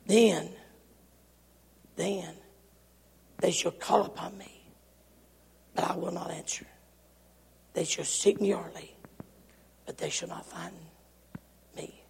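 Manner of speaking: low, very soft scripture warning; hushed, solemn pronouncement with long pauses; grave hush. Acted. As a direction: let each phrase drop nearly to a whisper. Grave scripture warning after the screaming.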